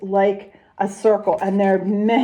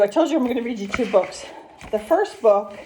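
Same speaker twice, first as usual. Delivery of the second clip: plain reading voice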